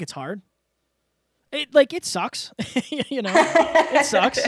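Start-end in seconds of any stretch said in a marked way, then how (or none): 1.5-4.4 high, slow, laughing through it. Real, not acted. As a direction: laugh it off gently. Laughing about crying.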